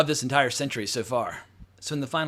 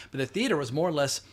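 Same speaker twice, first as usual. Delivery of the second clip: ordinary talk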